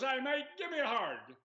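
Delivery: imitated Australian shout, pitched well up; Australian doctor. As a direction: boom it out like a hearty Aussie. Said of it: "in walks this kind of ruddy-faced, uh, Australian".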